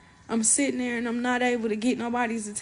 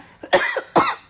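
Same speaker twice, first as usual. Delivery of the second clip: harsh real coughing fit; 8 dB louder than usual. Real, not acted. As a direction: cough hard, chest straining. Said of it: "Can't talk for too long".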